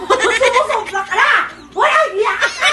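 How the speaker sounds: full-force high angry scream; very loud, high hammed-up fury; over-the-top angry. Shown as a demonstration. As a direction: explode in acted rage, drawing out the last phrase. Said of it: "Sobra namang angry yun".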